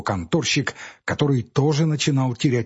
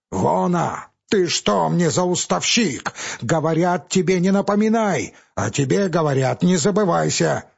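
raised furious quarrel; furious threats; 5 semitones higher than usual. Acted. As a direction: threaten him, voice climbing. Clerk and Pavel shout threats.

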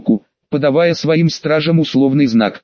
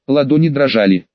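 ordinary delivery

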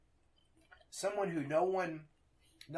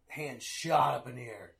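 usual speaking voice

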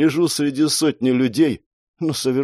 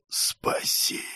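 whispered, fading plea; weak pleading; 9 dB quieter than usual. Acted. Dying boy begs to be saved, whispered pleading. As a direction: beg on the last of your breath.

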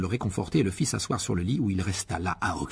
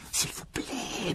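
soft, high, drawn-out stage whisper; urgent murmur; 13 semitones higher, 5 dB quieter, much slower than usual. A narrator's reading. Harry hushing the wailing elf, urgent whisper. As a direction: beg under your breath. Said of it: "murmura précipitamment Harry".

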